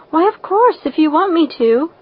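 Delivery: bright, lifted eager yes; a quick cheerful of course; cheerful assent. A narrator's reading. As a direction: chirp agreement happily. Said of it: "assented Anne cheerfully".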